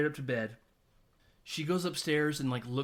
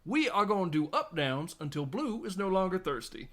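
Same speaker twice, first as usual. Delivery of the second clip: higher, emphatic tough-coach impersonation; Denzel Washington; 7 semitones higher than usual. Retold or imitated. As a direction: drill the team, voice lifted and punchy. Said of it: "does her best Denzel Washington impersonation".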